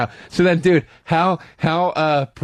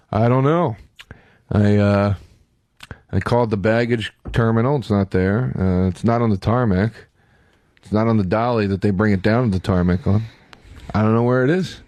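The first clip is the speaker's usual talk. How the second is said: low, sluggish airline clerk voice; dopey low-pitched desk guy; indifferent clerk; 6 semitones lower than usual. Retold or imitated. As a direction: drone it out low, couldn't care less.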